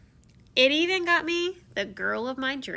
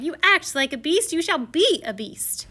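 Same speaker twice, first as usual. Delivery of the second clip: loud higher curse; cursing fairy; 6 semitones higher, 4 dB louder than usual. Retold or imitated. Evil fairy cursing the prince, loud and high. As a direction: lay the spell on him with scorn. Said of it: "she cried".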